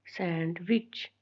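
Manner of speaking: Sandwich slow and hushed, sand, pause, विच; small vowel sound. Shown as a demonstration. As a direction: keep both beats of sandwich small and separate. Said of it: "वो small आ रही है".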